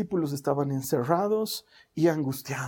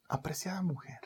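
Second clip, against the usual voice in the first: "Apreciada mujer" whispered, much softer; hushed whisper; 10 dB quieter than usual. Acted. Jesús gently calling to weeping María, whispered. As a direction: whisper "Apreciada mujer" tenderly, barely voiced.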